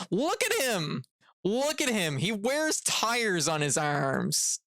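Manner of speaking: high, sneering look at him; mocking scorn. Real, not acted. Streamer jeering at Abigail's picture on screen. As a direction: point and jeer at the picture.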